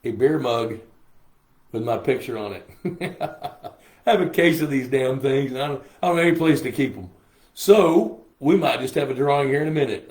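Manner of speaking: normal talking voice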